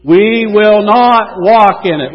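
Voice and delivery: loud, slow lead for a group; leading shout. Shown as a demonstration. Preacher leads congregation practicing Israel's words, slow shout. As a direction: shout it slowly so everyone can join. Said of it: "without me shouting".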